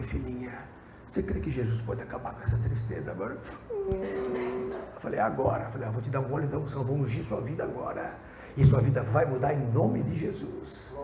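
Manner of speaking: tender. Retold or imitated. Doctor gently comforts the weeping patient, soft and tender. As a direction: soothe her like a daughter.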